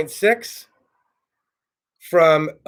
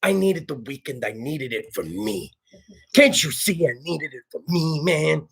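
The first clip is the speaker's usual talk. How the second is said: softer, lower mock-earnest plea; mock pleading; 4 semitones lower, 5 dB quieter than usual. Comic pleading for a weekend to himself, hushed mock plea. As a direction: plead quietly as if wounded.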